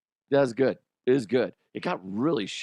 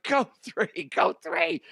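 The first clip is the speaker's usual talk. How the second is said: much higher, urgent call from third; coach yelling. Retold or imitated. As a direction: wave him on to third, yelling. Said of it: "and he's like".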